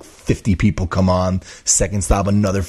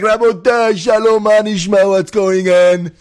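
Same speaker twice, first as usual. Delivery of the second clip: much higher and louder, beaming hello; excited greeting; 11 semitones higher, 5 dB louder than usual. Retold or imitated. Happy driver greeting the angry officials, high loud cheer. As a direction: burst in like the hero of the day.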